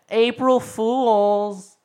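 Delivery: very high, loud, long-drawn yell; imitated sing-out prank shout; gleeful prank reveal. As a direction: stretch it out as a shouted gotcha. Sister springs the prank, retold.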